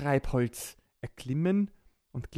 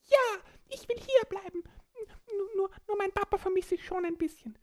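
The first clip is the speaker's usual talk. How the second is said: high squeaky little creature voice; small child voice; faster than usual. Acted. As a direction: speak like a tiny excited child. Borki answers.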